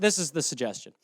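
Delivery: ordinary talk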